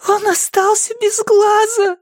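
very high, drawn-out tearful cry; крик сквозь слёзы. A narrator's reading. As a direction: cry it out through tears, pitch right up high. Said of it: "сквозь слёзы выкрикнула она". (отчаянный высокий крик сквозь слёзы)

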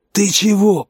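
hoarse wheeze from under a boot; wheezing, pinned down. A narrator's reading. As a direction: croak it out while pinned to the ground. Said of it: "прохрипел Штык из-под Серёгиного ботинка".

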